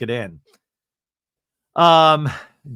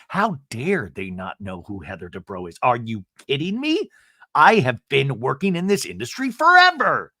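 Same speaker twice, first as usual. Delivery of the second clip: mock indignation. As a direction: play the offended star, swelling to outrage. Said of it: "Now Heather's pissed off about that".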